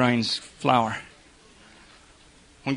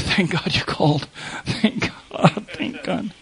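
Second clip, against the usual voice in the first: breathless relief; 2 semitones higher, 5 dB quieter, slower than usual. Retold or imitated. Voicing a person relieved by call.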